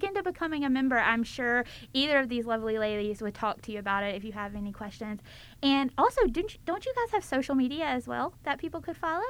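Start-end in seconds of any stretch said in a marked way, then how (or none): none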